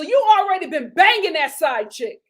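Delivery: high, loud accusing call-out; loud accusation. Real, not acted. Vlogger calling out the husband about his side chick. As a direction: call him out, scandalised.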